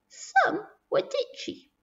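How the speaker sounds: very high, soft, slow squeak; tiny voice. A narrator's reading. Narrator showing children how small the sculptures were, in tiny voice. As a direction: shrink it to a teeny high voice.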